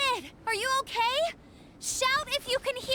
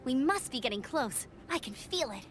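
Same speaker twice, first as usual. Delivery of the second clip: usual speaking voice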